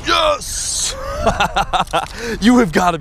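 high shout of victory; real triumphant yell. Real, not acted. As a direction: yell yes as the bass lands. Lands the bass.

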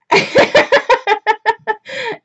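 a high, bursting giggle at a distraction. Real, not acted. Host giggling at someone off camera, high laugh. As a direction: laughs off the interruption.

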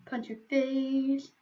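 normal talking voice

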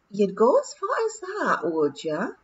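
Rob's pixie voice. Acted. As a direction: tease William casually. Rob chatting in pixie voice.

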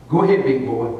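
low, dry imitation of wife; understated nudge to a stubborn husband; mild goading. Wife sending preacher to tell the lady, mild goading.

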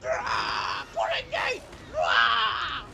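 high, loud mocking yells; mocking imitation. Player mocking how the villagers sound, shrill loud shouts. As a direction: shriek it back in mockery. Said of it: "That's what you sound like".

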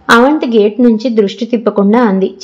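usual speaking voice

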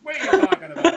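high, loud baffled huh laughing through it; mock confused. To the joke-teller, confused and laughing. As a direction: play the puzzled listener, half laughing. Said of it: "quoted listener's confusion".

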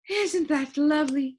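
high, dreamy lady's voice; sighing delight. A narrator's reading. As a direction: sigh it out in a high character voice. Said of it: "she sighed".

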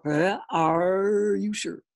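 slow, drawn-out stunned question; hesitate, then stretch out the question; taken-aback father, very slow; stammering doubt. A narrator's reading. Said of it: "stammered his father".

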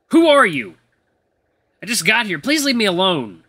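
high, loud panicked challenge; frightened demand. Acted. Eli begs the supposed ghost to leave him alone, panicking. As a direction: cry out, then plead with a stretched ending.

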